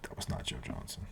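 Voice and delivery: a near-silent whisper. Real, not acted. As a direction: hiss the denial under your breath. Denying guess, then repeats it.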